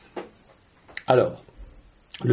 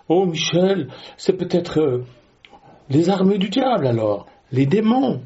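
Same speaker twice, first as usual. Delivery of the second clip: higher-pitched mock protest from a listener; alarmed objector; 6 semitones higher than usual. Listener objects to Michel about the devil's armies, mock-alarmed. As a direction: play the worried believer interrupting the preacher.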